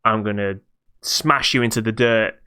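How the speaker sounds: usual speaking voice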